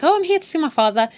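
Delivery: high, quick, sweet request; mock cheery girl. Sarah tells the receptionist why she came, mock cheery. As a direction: imitate an eager daughter at reception.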